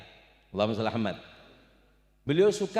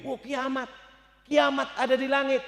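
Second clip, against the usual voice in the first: angels panicking; 10 semitones higher, 3 dB louder than usual. Acted.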